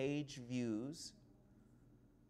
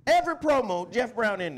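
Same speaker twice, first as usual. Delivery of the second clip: loud, high hype announcer voice; wrestling promo; 8 semitones higher, 12 dB louder than usual. Acted. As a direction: cut a big wrestling promo. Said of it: "I'm doing the promo".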